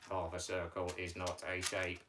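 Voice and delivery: low monotone puppet objection; puppet Mike's voice. Pretend. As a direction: object on one low, level note. Teacher voices puppet Mike.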